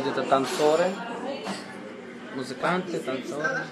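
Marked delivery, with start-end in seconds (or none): none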